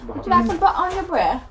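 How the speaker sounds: a lightly posh English "Would you like some butter on your bread?" with the T in "butter" swallowed and no R; "butter" said "buh-uh" with the T and the R both dropped, like a posh Englishman; mock posh English accent. South African mocking her English friends' accent, lightly posh English. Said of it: "Where's the T's? Where's the R's?".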